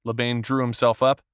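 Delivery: ordinary delivery, nothing marked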